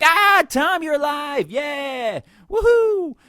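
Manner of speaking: high, loud hammed-up whoop; mock cheer. Mocking a mourner greeting Tom on Thursday, overblown cheer. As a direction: cheer like he just came back. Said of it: "you were like".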